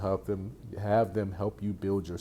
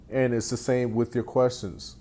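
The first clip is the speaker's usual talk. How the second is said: ordinary delivery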